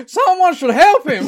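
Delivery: high, loud mock cry for help; mock alarm. Voicing the wrestler watching a beating, mock alarm. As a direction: call out in hammed-up panic, laughing.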